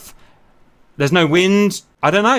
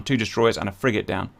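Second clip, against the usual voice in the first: much softer, lower repeat; quiet; 9 semitones lower, 7 dB quieter than usual. Real, not acted. Gamer repeating the kill tally to himself, quiet. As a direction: echo it to yourself.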